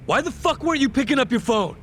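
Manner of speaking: high, sharp angry question; angry demand. Acted. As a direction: snap at him for ignoring calls. Angry boss demands answer.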